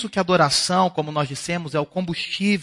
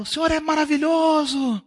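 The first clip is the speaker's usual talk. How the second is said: praise shout; 10 semitones higher than usual. Acted. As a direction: belt it out like a cheering crowd. Cartoon Earth shouting praise.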